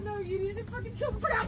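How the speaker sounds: usual speaking voice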